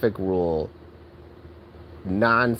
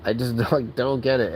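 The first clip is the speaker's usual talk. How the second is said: normal talking voice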